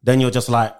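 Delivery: normal talking voice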